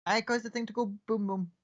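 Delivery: very high, sugary anime schoolgirl chirp; overly happy anime girl. Acted. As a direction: squeak it like a gleeful anime schoolgirl. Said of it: "overly happy anime school chick".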